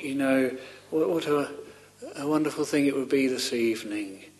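ordinary talk

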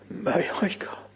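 a whispered plea, I leaning in; hushed whisper. Shown as a demonstration. Preacher whispers the plea to the Lord, hushed. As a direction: whisper it, stress on I. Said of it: "Lay the emphasis there".

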